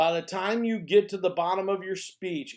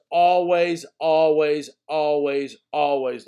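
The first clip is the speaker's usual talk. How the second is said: loud, evenly hammered ALWAYS, easing on the last; emphatic repetition; 7 dB louder, slower than usual. Real, not acted. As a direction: drive home "always" beat by beat. Repeats the rule for emphasis.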